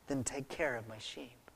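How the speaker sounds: very soft, low and steady; quiet calm charge. Acted. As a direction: answer the outburst almost under your voice. Acted Jesus's quiet answer to outburst.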